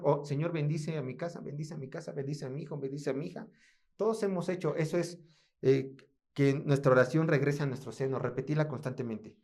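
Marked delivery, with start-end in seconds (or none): 0.2-3.5 quiet, pleading blessing murmur; lowered, intimate asking; soft imitated prayer.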